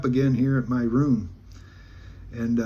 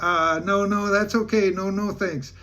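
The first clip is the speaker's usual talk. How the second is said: higher-pitched, hasty polite no; flustered refusal; 6 semitones higher than usual. Retold or imitated. Teller turning down sexual favors, retold flustered refusal. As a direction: wave the offer off, voice lifted.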